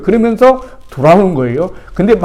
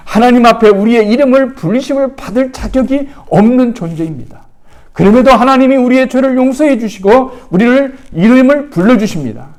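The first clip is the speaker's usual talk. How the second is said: fervent preaching; 9 semitones higher, 4 dB louder than usual. Acted. Pastor urging the congregation, fervent and rising. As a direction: raise the voice higher and louder on the grace.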